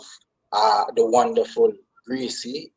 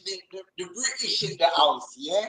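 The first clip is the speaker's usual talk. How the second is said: put-on playful London accent, higher; London shout-out; 7 semitones higher than usual. Guest giving shout-out to British listeners, playful London. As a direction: hype up the British crowd.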